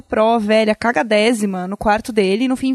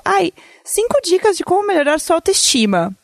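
mocking youtuber voice; 7 semitones higher than usual. Mocking a youtuber's tips video.

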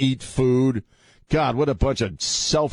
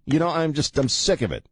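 usual speaking voice

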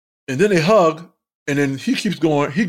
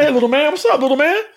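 put-on friendly greeting to a kid; 9 semitones higher, faster than usual.

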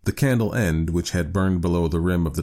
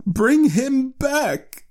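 very high, slow drawn-out wail; desperate cry; 4 dB louder, much slower than usual. A narrator's reading. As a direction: cry out each word, demanding your son. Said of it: "cried the old woman".

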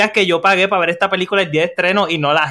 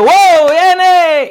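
excited cheer; 10 semitones higher, 5 dB louder than usual. Retold or imitated. Retelling cheering in cinema.

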